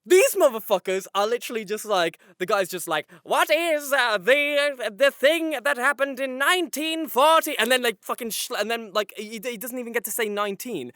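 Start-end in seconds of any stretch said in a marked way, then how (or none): 3.2-7.5 lightly hammed-up quiz host, higher and louder; mock quiz-show delivery; mock quiz questioner. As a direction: draw out the question slowly, halting partway. Mimicking a quiz show question being asked.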